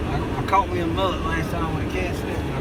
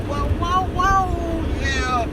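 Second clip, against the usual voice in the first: surprised whoa; 14 semitones higher, much slower than usual. Real, not acted. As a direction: yelp it out in sudden surprise. Sudden bite or surprise on camera.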